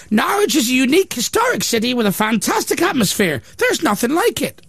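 pitched well up, proud and prim; high mock quote voice. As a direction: read it like a smug local booster.

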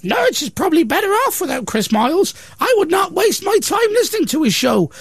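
high, prim local voice reading her quote; mock quote voice. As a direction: play the proud pub landlady, pitched well up.

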